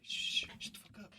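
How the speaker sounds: sharp urgent whisper; hushed whisper. Retold or imitated. Hiding on the couch from his cousins, urgent whisper. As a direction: hiss it so nobody hears you.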